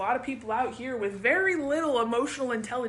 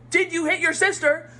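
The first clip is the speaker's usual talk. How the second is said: louder, higher mock accusation; accusing parent; 4 semitones higher, 5 dB louder than usual. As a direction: demand it like a cross parent.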